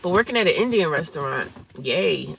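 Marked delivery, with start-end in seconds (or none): none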